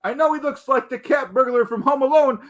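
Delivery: usual speaking voice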